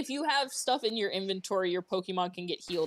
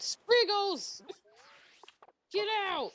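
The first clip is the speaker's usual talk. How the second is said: high calling out; 10 semitones higher, slower than usual. Real, not acted. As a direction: call the pet out, high. Calling the Pokemon out.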